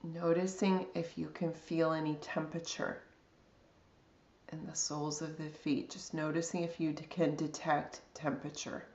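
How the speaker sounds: calm, unhurried body scan guidance with long pauses; guided meditation. Real, not acted.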